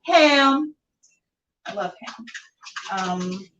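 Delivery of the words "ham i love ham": ordinary delivery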